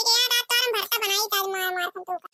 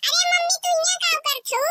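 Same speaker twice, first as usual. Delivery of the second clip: put-on child voice; 6 semitones higher than usual. Child calls out to mother.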